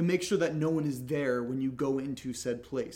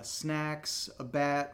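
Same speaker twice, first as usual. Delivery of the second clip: usual speaking voice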